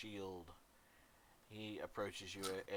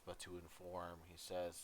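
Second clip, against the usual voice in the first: usual speaking voice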